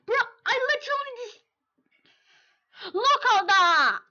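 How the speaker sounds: high, loud frustrated yell; gamer rage. Real, not acted. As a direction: yell in real disbelief at the boss. Gamer raging at boss.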